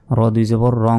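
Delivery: রং with a long nasal hum; ghunna. Shown as a demonstration. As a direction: hold the ng of রং through the nose. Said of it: "গুন্না হবে".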